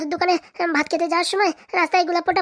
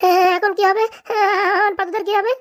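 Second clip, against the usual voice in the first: panicked; 5 semitones higher than usual. Acted. Panicked about kidnapped Padu.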